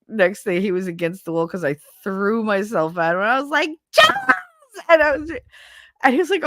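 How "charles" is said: high, loud squeal of greeting; excited cry. Retold or imitated. Teacher throwing herself at her principal friend, excited cry. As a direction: shriek the name in delighted greeting.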